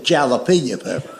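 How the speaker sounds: "jalapeno" begun like "jal", J sounded; cousin's mispronunciation. Retold or imitated. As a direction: say "jalapeno" with a hard English J, as in jam. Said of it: "But he calls it".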